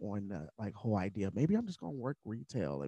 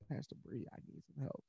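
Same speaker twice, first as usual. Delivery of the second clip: whispered, much softer, pleading; whispered plea; 4 semitones higher, 12 dB quieter than usual. Retold or imitated. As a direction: barely breathe out the request. Calling pastor for help.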